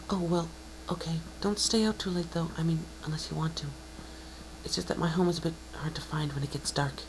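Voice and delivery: high, soft pony voice trailing into a whisper; shy and hushed, dropping to a whisper at the end; timid mare fading to whisper. Acted. Shy cousin fretting about the dark, high and whispering.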